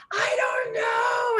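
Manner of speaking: very high, drawn-out crying wail; wailing upset kid. Acted. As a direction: whine it like a meltdown child. Upset kid answering parent.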